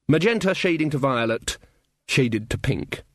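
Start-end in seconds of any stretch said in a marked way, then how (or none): none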